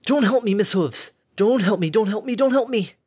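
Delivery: high, loud, frantic repeated plea; frantic pleading. Acted. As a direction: beg them to stop, panicking. Harmony begs Derpy to stop.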